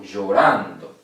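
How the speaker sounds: Llorando with the middle syllable ran pushed hard; stress on RAN. Shown as a demonstration. Teacher to class, showing the stressed syllable of Llorando. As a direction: lean into the ran of Llorando, ending on a fall. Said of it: "Acá".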